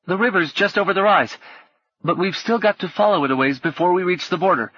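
high-pitched street lad; high boyish voice; boy Gally, explaining. A narrator's reading. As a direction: explain the route as a young boy.

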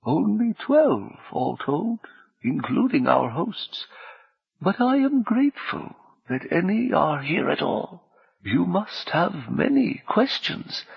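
slow, soft, high-pitched tones; soft otherworldly high voice; high, almost feminine voice. A narrator's reading. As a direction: speak gently and high, unhurried. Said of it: "those high, almost feminine tones".

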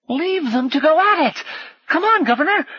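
put-on very high-pitched street boy; high, urgent boyish yell; urgent calling. A narrator's reading. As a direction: urge him away from the fight. Boy urges flight.

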